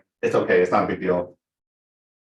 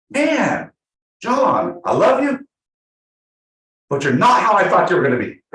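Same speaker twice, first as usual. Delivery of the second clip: much higher, emphatic retold voice; imitated client; 9 semitones higher, 5 dB louder than usual. Client tells John he has changed, high imitated voice. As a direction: push it up high, drawing out the address.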